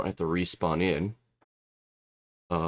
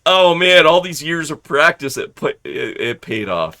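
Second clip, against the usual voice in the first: loud, high, thrilled; loud, high start that settles down; excited; 6 semitones higher, 9 dB louder than usual. Retold or imitated. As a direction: gush that the practice paid off. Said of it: "I'm like".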